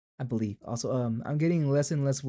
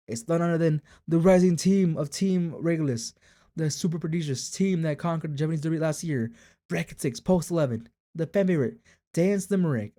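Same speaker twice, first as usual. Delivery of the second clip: higher, building introduction of a star; race announcer; 4 semitones higher, 4 dB louder than usual. Acted. Announcer revealing the fan favorite, hyped. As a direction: hype the favorite like a broadcaster.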